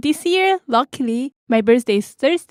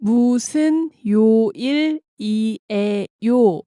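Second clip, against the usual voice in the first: broken down; faster than usual. Shown as a demonstration. Native speaker breaking the question down for learners, syllable by syllable. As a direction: separate every syllable for learners. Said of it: "break that down really quickly".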